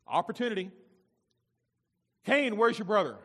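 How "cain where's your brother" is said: high, loud demanding question; confronting call. Acted. God confronts Cain about his brother, confronting call. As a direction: call him to account.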